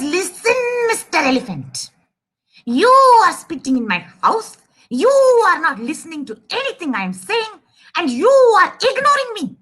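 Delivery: indignant, leaning hard on YOU; very angry mouse. Acted. Mouse accusing the elephant of ignoring it, very angry. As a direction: scold crossly with swooping emphasis. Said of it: "Now mouse gets very, very, very angry".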